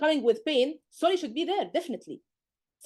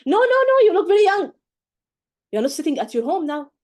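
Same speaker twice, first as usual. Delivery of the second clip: much higher and louder, gushing protest; overexcited mock gushing; 6 semitones higher, 7 dB louder than usual. Shown as a demonstration. Teacher shows the wrong way, gushing high and loud at the patient. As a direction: overdo it like chatting at home. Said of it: "But don't".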